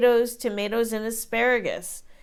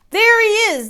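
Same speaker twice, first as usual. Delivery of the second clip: much higher, louder jeering cry; bullies shouting; 12 semitones higher, 9 dB louder than usual. A narrator's reading. Bullies spot Jack at the bottom, shouting. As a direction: yell it as he appears. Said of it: "they cried".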